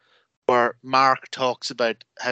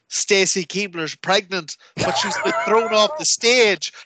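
high-pitched mock shock; melodramatic commentator; 7 semitones higher than usual. Commentators revealing a tasteless storyline twist, mock-horrified. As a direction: announce it as overblown wrestling drama.

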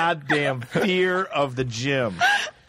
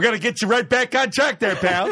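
louder, upbeat gym trainer pep; pushy trainer; 7 semitones higher, 3 dB louder than usual. Retold or imitated. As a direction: cheer him on like a pushy personal trainer. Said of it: "a trainer coming up to me going".

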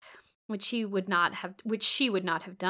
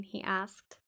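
ordinary delivery, nothing marked

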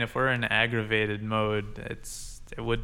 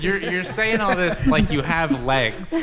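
louder, slow, laughing tease; 7 semitones higher, 7 dB louder, slower than usual. Real, not acted. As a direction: laugh as you tease him. Laughing teasing remark.

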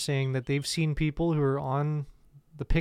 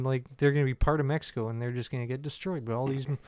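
normal talking voice

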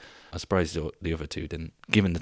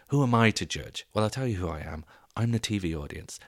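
normal talking voice

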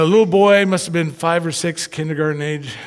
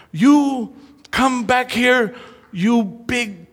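much higher, slow mock toddler demand; louder, slow little-girl threat with a laugh; little girl scolding; 8 semitones higher, 3 dB louder, much slower than usual. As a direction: play the tiny girl shaking fists at dad. Toddler scolding her dad playfully.

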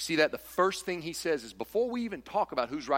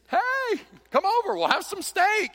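chirpy invitation; 5 dB louder than usual. Retold or imitated. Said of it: "and you're just".

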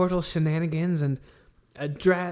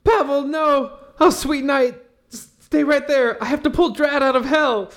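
high, loud frantic cry for help; panicked shout; 11 semitones higher, 4 dB louder than usual. Acted. Character screaming for her fallen friend, high and frantic. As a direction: yell in a panic as a friend falls.

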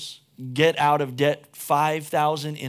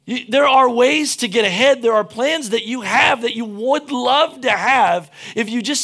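louder and higher, driving the point home; louder, higher sermon at full force; fired-up preaching; 7 semitones higher, 8 dB louder than usual. Acted. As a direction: preach it with rising passion.

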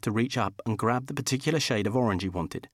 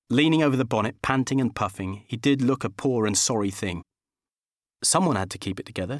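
ordinary delivery

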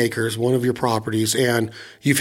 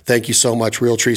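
ordinary talk, nothing marked

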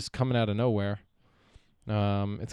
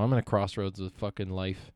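ordinary talk, nothing marked

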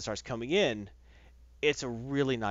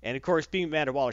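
ordinary talk, nothing marked

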